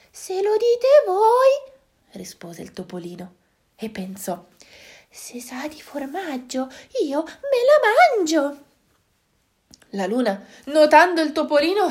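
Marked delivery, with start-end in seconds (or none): 0.1-1.7 much higher, slow little mouse; a timid tiny mouse. Acted. Mouse answers the animals, in a high slow timid voice. 5.1-8.6 high, slow mouse voice; a tiny mouse dreaming of cheese; little mouse. Acted. Mouse plans to eat the moon, in a high tiny voice.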